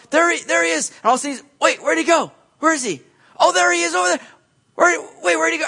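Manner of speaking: panicking disciples. Retold or imitated.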